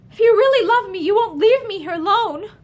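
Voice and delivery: passionate cry. A narrator's reading. Pansy crying out to Lynn not to leave her, passionate and loud. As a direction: cry it out to him. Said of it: "she cried out passionately".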